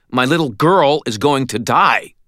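high, loud, desperate outburst; indignant demand. A narrator's reading. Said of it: "I demanded".

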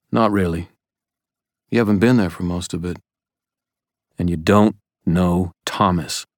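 usual speaking voice

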